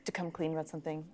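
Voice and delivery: soft, sinking low; gentle confession. Acted. Friend coming clean about his dad, quiet reluctant confession.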